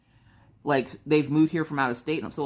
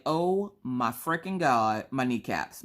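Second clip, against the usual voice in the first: slow groan; slower than usual. Retold or imitated. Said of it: "my legs felt like Jell-O".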